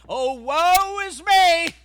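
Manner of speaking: slow hammed-up whine of woe; mock complaining. Preacher mocking a sorry-mouthed complainer, slow and loud. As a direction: drag out a mock lament. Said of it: "complaining and sorry mouth".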